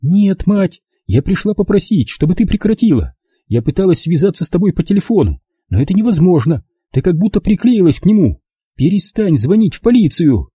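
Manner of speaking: put-on high-pitched, insistent young woman's voice; exasperated daughter. Daughter asking mother to stop calling police, exasperated. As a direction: push back high and firm.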